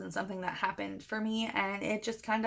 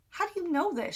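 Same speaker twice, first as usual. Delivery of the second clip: high-pitched baffled demand; acted-out astonishment, much higher; incredulous question; 9 semitones higher than usual. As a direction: question a friend in disbelief, voice jumping up. Acted disbelief at friend's news.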